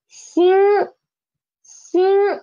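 "xin" held high and level, first tone; teacher's high level first tone. Shown as a demonstration. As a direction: sing "xin" high and flat. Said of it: "the tone is the first tone".

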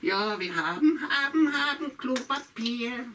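high cackling witch gloating. Acted. Witch gloating over her loot. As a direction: crow it out like a greedy witch.

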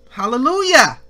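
loud, high exultant hallelujah; shouted hallelujah. Real, not acted. Preacher shouting praise after the gospel point.

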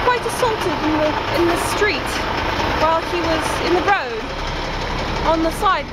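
mock-British storyteller, pitch lifting; affected, lilting fake British; put-on British accent. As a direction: tell it as a proper British vlogger. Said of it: "I'm going to vlog in a British".

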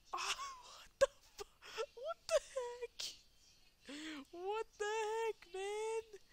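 very high, fast cry of disbelief; shrieked shock. Real, not acted. Gamer as the monster eats his character, shrieking. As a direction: squeal it in horror.

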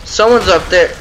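normal talking voice

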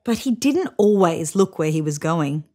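usual speaking voice

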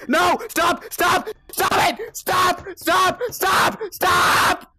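high, loud repeated shouts; panicked screaming. Real, not acted. Player unable to move, panicked high screaming. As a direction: scream each word as it closes in.

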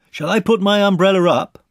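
high, loud question; calling up. A narrator's reading. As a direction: shout the question overhead. Boy calls up to Pooh.

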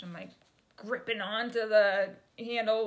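usual speaking voice

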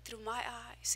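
gentle voice of God. Retold or imitated. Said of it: "the Lord says".

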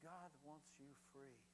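very soft, low delivery; hushed earnestness. Real, not acted. Preacher telling congregation what God wants, hushed and earnest. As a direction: say it quietly and sincerely.

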